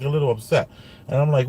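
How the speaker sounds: ordinary delivery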